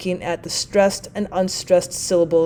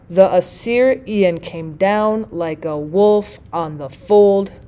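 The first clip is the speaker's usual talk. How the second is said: slow da-da-DUM beat, landing on "syr" of "Assyrian", "down", "wolf", "fold"; anapestic stress; slower than usual. Shown as a demonstration. Teacher demonstrating the anapest with an example, anapestic stress. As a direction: scan it slowly, two light syllables before each beat, ending firmly on "fold". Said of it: "two unstressed syllables followed by a stressed one".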